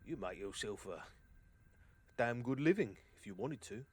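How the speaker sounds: lightly voiced mercenary weighing up a recruit; accented sellsword making an offer; sizing up. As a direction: casually size up the big fella. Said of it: "like, sizes you up".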